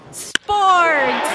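big excited announcement; excited reveal. Real, not acted. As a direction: punch the word out with a grand reveal. Announcing the next theme.

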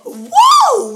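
high, loud whoa; excited shout. Real, not acted. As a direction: cheer the guest in. Host cheers guest arrival.

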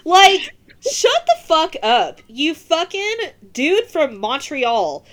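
loud, high exasperated laugh-yell; angry outburst. Real, not acted. Ranting at Sammy on the podcast, loud and laughing. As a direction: blow up at him, laughing in disbelief. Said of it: "angry outburst at Sammy".

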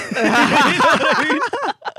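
loud, high burst of laughter; real laughing fit; big high laugh. Real, not acted. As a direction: crack up at your own joke. Laughing at his own story.

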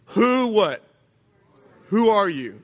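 suspicious question. Retold or imitated. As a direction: call out like a wary blind father.

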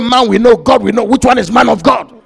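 acted assassin demanding an explanation; incredulous challenge. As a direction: throw it back loudly in disbelief. Assassin challenges the preacher's answer.

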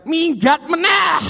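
high, loud, drawn-out cry of dismay; exasperated father. Acted. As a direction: wail it out like a dad at his wits' end. Father wails son ran off again.

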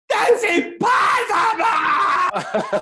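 high, slow, shouted mock despair; laughing mock anguish; hammed-up Luke cry. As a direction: wail it like Luke falling. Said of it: "I was just like".

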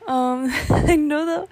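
ordinary talk, nothing marked

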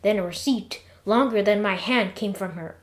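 plain reading voice

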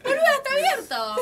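high, loud mock shout; acted exclamation. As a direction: shout it out in hammed-up exasperation. Acted shouted reply in the anecdote. (grito agudo y exagerado)

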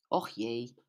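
low, soft sigh; sighing mother. Acted. Mother sighing at her daughter's story, low and soft. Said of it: "ze hoorde haar moeder zuchten".

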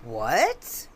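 stunned, low disbelieving what; shocked what. Acted. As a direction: hit by the shock. Shock at daughter's name.

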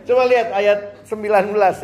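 ordinary talk, nothing marked

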